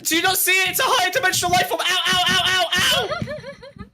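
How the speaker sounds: high, hurried panic breaking into yelps; frantic delusion. Pretend. Streamer begs co-host to see the creature, high frantic shouting. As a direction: insist on it wildly between cries of pain. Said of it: "I think he's off his pills again".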